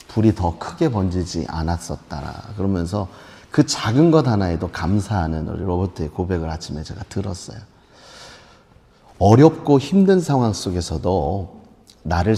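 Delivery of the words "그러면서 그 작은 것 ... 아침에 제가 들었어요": ordinary delivery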